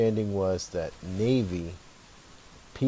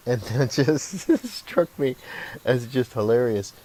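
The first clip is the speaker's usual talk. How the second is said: tickled by the memory, laughing between words; laughing while talking; 5 semitones higher, 5 dB louder than usual. Real, not acted. Amused by his own anecdote.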